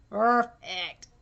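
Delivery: ordinary delivery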